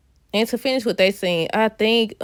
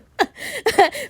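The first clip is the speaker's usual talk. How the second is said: laugh; 12 semitones higher than usual. Real, not acted. Laughing at her own made-up line.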